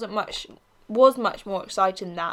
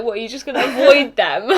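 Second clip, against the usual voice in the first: laughing mock question; 3 semitones higher, 9 dB louder than usual. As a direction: ask it through laughter. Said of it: "And Leisha's like".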